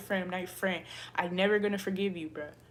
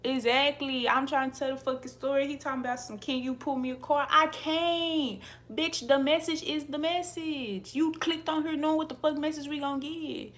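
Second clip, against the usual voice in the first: raised, higher voice in exasperation; mad; 5 semitones higher, 5 dB louder than usual. Real, not acted. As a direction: snap back at a requester, high and indignant. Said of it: "They be making me mad".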